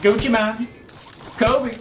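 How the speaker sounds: loud high-pitched calls; high shout. Real, not acted. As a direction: yell the names out loud. Shouting teammate names in game.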